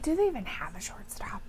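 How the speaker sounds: whispering. Retold or imitated. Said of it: "You're like".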